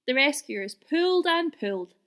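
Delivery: ordinary delivery, nothing marked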